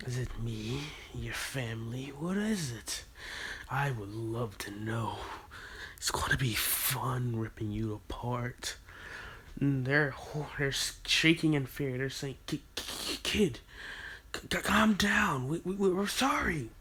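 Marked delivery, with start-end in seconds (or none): 0.1-8.8 low, much softer sinister threat; menacing hush. Acted. Naruto gloating over the horrified villagers, soft and sinister. 12.5-16.7 stammering, higher, half-whispered plea; scared stammer. Acted. Frightened villagers begging the kid to calm down, stammering. Villagers shaking in fear beg.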